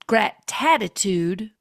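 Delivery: normal talking voice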